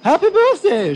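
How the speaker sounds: very high, loud royal greeting shout; shouted happy birthday. Acted. Queen calling out to Grandpa from the helicopter, high and loud. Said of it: "shouted the Queen and the small man".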